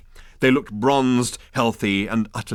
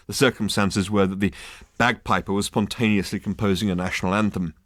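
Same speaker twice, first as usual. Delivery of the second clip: ordinary delivery, nothing marked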